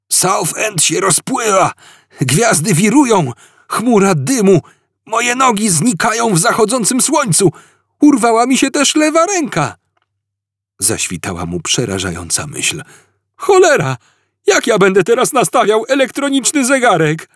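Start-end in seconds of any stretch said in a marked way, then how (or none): 0.1-9.8 much higher, frantic panicking cries; panicked. Acted. Arthur panicking as body dissolves. 13.4-17.3 much higher and louder panicked curse; horrified outburst. Acted. Arthur's horrified realisation.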